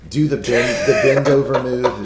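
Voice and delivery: laughing. Real, not acted. Laughing demo of eating beignets.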